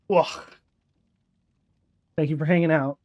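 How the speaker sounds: usual speaking voice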